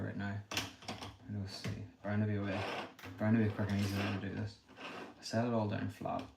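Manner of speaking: ordinary talk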